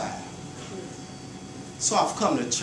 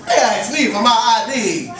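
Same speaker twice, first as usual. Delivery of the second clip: higher and louder, laughing edge; raised declaration; 7 semitones higher, 6 dB louder than usual. Acted. Poet mocking the ID checks, raised and laughing. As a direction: throw it back at them. Repeated line, stronger take.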